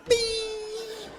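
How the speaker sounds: high "Bing!"; a cartoon ding; playful sound effect for throwing the plate away; mock bing.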